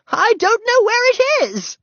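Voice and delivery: very high, loud, drawn-out wail; panicked cry. A narrator's reading. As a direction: cry it out helplessly. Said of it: "cried the new neighbor".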